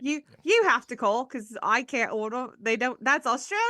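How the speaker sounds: badly faked British accent, slowed down; wobbly British accent drifting toward Australian. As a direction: attempt a British accent and miss. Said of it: "he would say it with a British accent".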